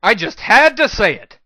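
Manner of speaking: high, loud, fed-up cry; shout. A narrator's reading. Hero shouts over the chittering scorpions, exasperated. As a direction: yell it over the noise of the horde. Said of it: "I shouted".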